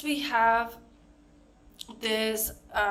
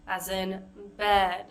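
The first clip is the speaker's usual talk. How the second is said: ordinary delivery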